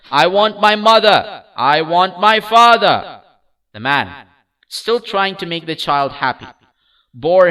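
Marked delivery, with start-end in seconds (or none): 0.1-3.2 high, loud wailing; weeping cry. A narrator's reading. Lost child wails to the kind stranger, weeping. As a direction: wail it through tears. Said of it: "just sobbed".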